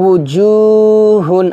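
উজুহুন chanted very slowly, each sound held; drawn-out recitation. Shown as a demonstration. Teacher chanting উজুহুন for students to repeat. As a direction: draw out উজুহুন in a slow Quranic chant. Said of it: "জিমের মাখরাজটাকে".

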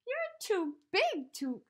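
sorry but firm, too big to cuddle; Eddie's voice. A narrator's reading. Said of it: "he said".

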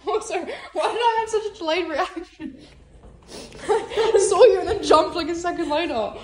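high, laughing, shaken voice; scream into giggles. Real, not acted. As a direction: talk through giggles after a fright. Laughing after being scared.

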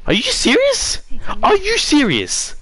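very high, incredulous questioning; high-pitched shock at a twist; stunned disbelief. Real, not acted. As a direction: squeal it in real disbelief.

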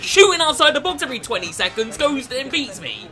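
seething at the opponent; fuming. Real, not acted. Gamer fuming after losing, heated angry rant. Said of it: "I'm fuming".

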